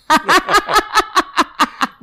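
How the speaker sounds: loud, high burst of laughter; cracking up. Real, not acted. Couple cracking up mid-argument. (carcajada fuerte y aguda)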